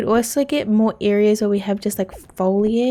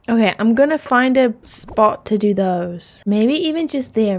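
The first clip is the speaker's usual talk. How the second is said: ordinary talk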